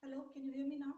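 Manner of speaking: faint voice far from the mic; very soft, distant call; barely audible. Real, not acted. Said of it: "your voice is very low".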